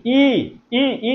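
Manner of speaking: ই held long and pitched high; single out ই as a ringing long "ee"; teacher sounding out ই on its own; isolated vowel sound. Shown as a demonstration. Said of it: "ইটা ক-, কখন বলছি?".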